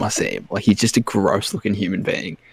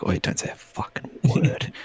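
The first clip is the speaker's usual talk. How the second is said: mock hushed warning; 7 dB quieter than usual. Imitating hushed group warning.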